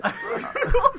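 high laugh. Real, not acted. Laughs after joke.

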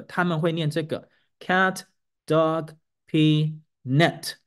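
cat、dog、pee、net as one sentence, falling at net; falling sentence tune. Shown as a demonstration. Teacher shows the final fall on net. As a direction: let the tune drop at the end, on net. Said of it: "最后下降那个".

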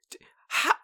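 breathy whisper of alarm; whispered panic. Retold or imitated. Whispering to himself after the toast arrives, panicked. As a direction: hush it like a guilty panic.

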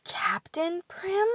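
slow, high incredulous whisper. A narrator's reading. Pixie whispering the name he finds on the letter. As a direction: breathe the name out in disbelief. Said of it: "he whispered aloud when he reached the signature".

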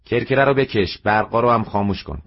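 mother ordering her son to close the shop; commanding. A narrator's reading. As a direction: bark it at the child.

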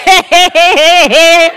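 high, loud mock exclaiming; mimicking children's reaction. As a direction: imitate kids reacting to a sibling in tears. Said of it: "when they see someone crying".

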